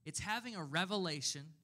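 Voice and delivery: ordinary talk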